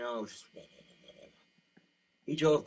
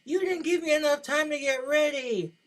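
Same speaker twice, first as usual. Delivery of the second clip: much higher, much louder mock whine, slowed; pouty wife; 11 dB louder than usual. Wife blaming husband in the car, shrill mock whine. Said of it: "she's like".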